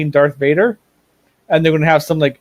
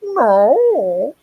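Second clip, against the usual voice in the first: high dismissive producer; 9 semitones higher, much slower than usual. Retold or imitated. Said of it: "And then they're like".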